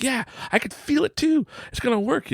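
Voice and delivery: high-pitched, excited playing along; match a child's hope in a high voice; mock fervor. Dad plays along with his son, high excited fervor.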